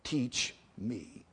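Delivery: very slow, low, hushed plea; heartfelt prayer. Real, not acted. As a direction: lead them softly into prayer, word by word. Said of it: "Ought not we to pray it this morning afresh?".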